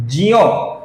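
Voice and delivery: ज्यो as a slow j gliding into y; Sanskrit conjunct. Shown as a demonstration. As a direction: say ज्यो with half j plus y. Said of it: "ये इसका सही उच्चारण होता है".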